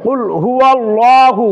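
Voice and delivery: slow হুয়াল্লাহু with rounded, heavy 'law'; careful thick lam. Shown as a demonstration. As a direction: recite হুয়াল্লাহু slowly, dark full L. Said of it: "খেয়াল করে পড়তে হবে". (হুয়াল্লাহু-র লাম মোটা করে)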